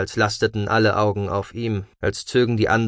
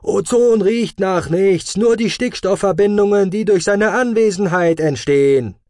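bellowed know-it-all; 10 semitones higher, 6 dB louder than usual. A narrator's reading. Inner know-it-all yells a senseless correction at Marv, bellowing. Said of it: "der ihm unsinnig zubrüllte".

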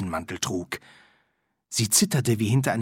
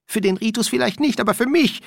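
much higher, fast and louder, a whiny complaint; a petulant dean grumbling; high, quick moaning; whining; 12 semitones higher, 5 dB louder, faster than usual. Acted. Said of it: "nörgelte der Dekan".

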